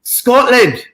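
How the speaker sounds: put-on a quick, high-pitched stab at a Scottish accent; mock Scottish accent. Streamer greets a fan from Scotland in a mock Scottish accent. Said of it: "if I did the accent wrong".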